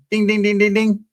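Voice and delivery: high sing-song ding ding; jackpot bell. Pretend. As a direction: chime it out like a game-show bell. Game-show jackpot bell reveal.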